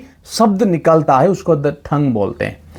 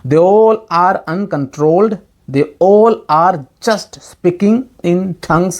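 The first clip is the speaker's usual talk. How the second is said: very slow, leaning on all; slow stressed quote; much slower than usual. Shown as a demonstration. Preacher reads the verse to the congregation, very slow, stressing all. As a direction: drag out they ALL for the listeners. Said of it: "यहाँ पे लिखा है कि they all".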